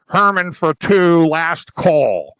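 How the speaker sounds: low, slow announcer call; restaurant host calling. Retold or imitated. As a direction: call out the waiting list like a bored host. Mimicking host calling names.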